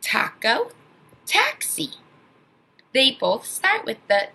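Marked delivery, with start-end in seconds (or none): 1.2-1.9 "taxi." much higher, stressed t; teacher comparing first sounds; comparison demo. Shown as a demonstration. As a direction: hit the t of "taxi." hard. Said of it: "same sound as taco".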